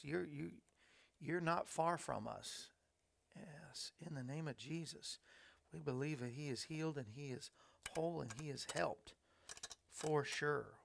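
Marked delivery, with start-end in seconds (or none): 3.3-10.7 soft, low, earnest praying voice; quiet prayer. Real, not acted. Host praying for a viewer's sick husband, softly. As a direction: pray it softly over him.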